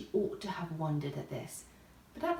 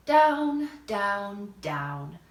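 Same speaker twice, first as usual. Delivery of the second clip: falling down; 6 dB louder, much slower than usual. A narrator's reading. Falling again.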